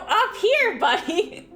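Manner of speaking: high, coaxing pet voice; talking to the cat. Retold or imitated. Retelling how she answered her cat, high pet-talk voice. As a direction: call the cat up like a pet owner.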